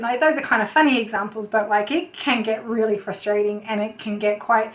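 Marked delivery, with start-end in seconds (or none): none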